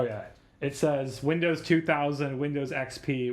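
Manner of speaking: ordinary talk, nothing marked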